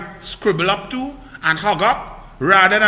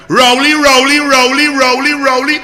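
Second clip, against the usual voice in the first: loud, higher, repeated rising call; 4 semitones higher, 9 dB louder than usual. Real, not acted. Commentator calling out the prime minister by name, loud and rising. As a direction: summon him like across a yard.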